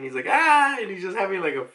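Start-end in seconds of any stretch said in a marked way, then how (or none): 0.3-0.8 high, loud open "ah" cry; imitated hysterical outburst; mock hysterical laugh. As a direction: burst out in a pitched-up mock cackle. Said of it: "he's kinda just laughing".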